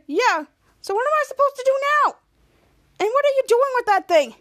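high, exasperated demand; indignant questioning. Retold or imitated. Captain Man challenges Kid Danger, high exasperated questions. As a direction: demand answers in a huff.

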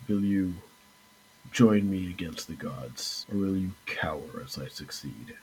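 a cold threat in a half-breathed voice; menacing villain. Acted. Casibios giving the heroes his choice, low soft menace. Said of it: "Casibios looks at him and goes".

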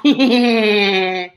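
slow evil cackle; villainous mwahaha laugh. Acted. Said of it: "The most evilish laugh".

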